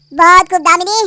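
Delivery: loud, mocking praise; sarcastic applause. Husband loudly mocking the mistress he caught. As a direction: clap back at her scheme.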